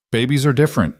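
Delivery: plain reading voice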